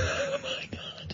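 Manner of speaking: breathless whispered Oh my God; a real stunned whisper; shocked whisper. Real, not acted. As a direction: whisper it in appalled disbelief. Cohost shocked by crude joke.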